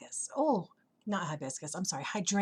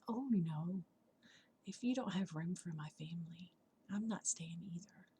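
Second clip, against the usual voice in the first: quiet firm refusal; 2 semitones lower, 8 dB quieter than usual. Acted. Butterfly standing by her family, hushed refusal. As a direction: refuse softly, nearly under your breath.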